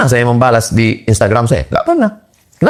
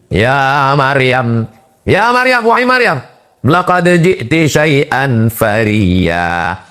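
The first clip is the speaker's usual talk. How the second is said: slow, higher chanted Quran verse; Quran recitation; 4 semitones higher, much slower than usual. Acted. (tilawah Quran yang lambat dan bernada)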